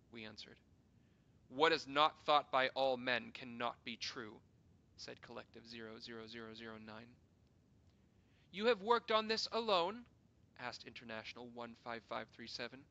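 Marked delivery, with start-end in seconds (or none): 1.5-4.4 stern loud decree. Acted. Council leader pronounces dogma. 8.5-10.1 sharp loud question. Acted. Councillor demands answer.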